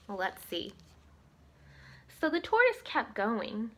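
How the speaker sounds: lively storybook reading voice; story narration. A narrator's reading. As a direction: read it like a bedtime story for kids. Reads story to children.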